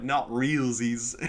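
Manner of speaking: very slow, drawn-out mocking taunt; mock baby talk. Taunting the misspelling opponent, slow mocking drawl. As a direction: stretch it out to mock him. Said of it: "I'm like".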